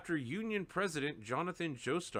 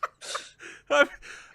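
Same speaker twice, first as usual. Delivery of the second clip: wheezing laugh; 11 semitones higher, 5 dB louder than usual. Real, not acted. Laughs at the joke.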